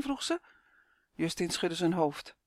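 ordinary delivery

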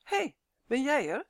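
verrast hoger en luider uitgeroepen. Acted. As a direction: verbaasd opkijkend naar de bezoeker. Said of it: "zei ze verrast". (verraste begroeting van Tonia)

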